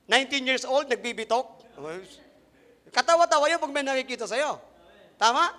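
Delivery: ordinary delivery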